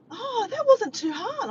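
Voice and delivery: high, loud, drawn-out retold pride; chuffed with himself. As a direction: imitate a proud, relieved climber. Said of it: "he was so chucks with himself".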